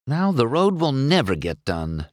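ordinary delivery, nothing marked

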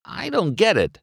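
high-pitched bafflement; confused. A narrator's reading. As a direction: say it utterly puzzled. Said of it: "McQueen was really confused now".

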